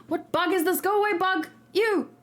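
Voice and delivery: sudden gasp, then high-pitched alarm; startled by a bug. Real, not acted. Streamer shooing a bug away mid-stream, startled and high-pitched.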